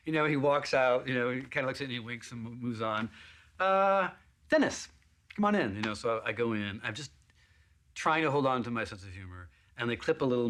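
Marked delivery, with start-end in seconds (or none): 3.6-5.8 high, breezy call-in; casting director calling Dennis in, lightly mocked; bored summons. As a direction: call the next actor in, mock casual. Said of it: "casting director calls him in".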